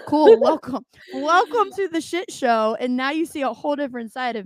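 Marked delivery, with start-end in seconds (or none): 0.1-0.4 Cool. said louder and higher with a rising tune; sarcastic cool. Shown as a demonstration. Dismissing listeners who brought up her podcast, mock bright. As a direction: throw back Cool. with a mocking lift.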